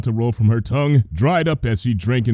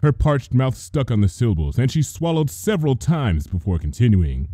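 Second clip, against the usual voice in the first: plain reading voice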